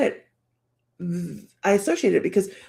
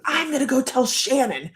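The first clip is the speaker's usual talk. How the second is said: lightly mocked, higher-pitched tattletale; tattling husband imitation; 5 semitones higher than usual. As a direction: threaten to tell on someone, hammed up slightly.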